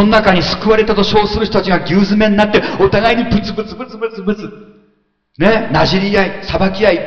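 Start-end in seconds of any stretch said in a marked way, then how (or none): 2.8-4.5 quick muttered grumble sounds; mock grumbling. Preacher imitates believers grumbling in the crowded ship, fast mock mutter. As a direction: rattle off complaining mumbles.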